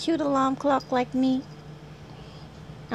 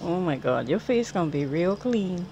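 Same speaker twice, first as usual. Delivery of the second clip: normal talking voice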